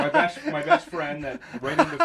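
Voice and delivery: laughing through the words; high, loud laugh while talking. Real, not acted. Laughing about his best friend.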